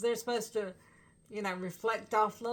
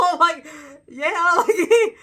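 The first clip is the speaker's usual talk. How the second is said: loud laughing, much higher; 7 semitones higher, 15 dB louder than usual. Real, not acted. Fan laughing at the singer's joke, loud and high. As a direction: crack up with delight.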